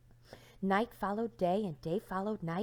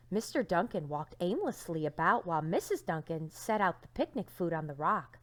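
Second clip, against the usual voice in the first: ordinary delivery, nothing marked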